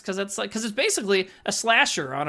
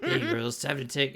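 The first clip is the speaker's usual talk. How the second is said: Walter impression; 6 semitones lower, 6 dB quieter than usual. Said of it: "talks exactly like Walter".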